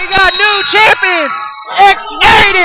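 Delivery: loud, high champion call; excited announcer. Acted. Co-commentator calls the win.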